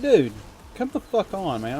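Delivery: retold telling-off of a rude friend in a restaurant; pissed off. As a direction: snap at the friend to knock it off. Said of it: "and I was like".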